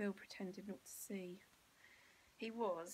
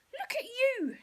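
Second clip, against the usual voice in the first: much higher, loud shocked cry; horrified mother; 13 dB louder than usual. Acted. Mother shrieks in horror at Bill's ruined frock. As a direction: recoil in horror. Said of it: "she declared".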